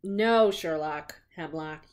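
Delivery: plain reading voice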